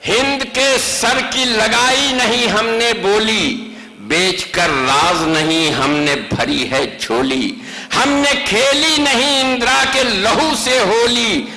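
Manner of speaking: rising, declamatory mushaira recitation; stately nazm recitation. Acted. Stanza recited again. (پرجوش مشاعرے کی نظم خوانی)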